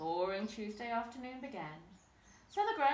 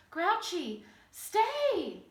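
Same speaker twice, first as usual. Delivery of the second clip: panting plea; 8 semitones higher, 8 dB louder, much slower than usual. A narrator's reading. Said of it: "panted the Grunt".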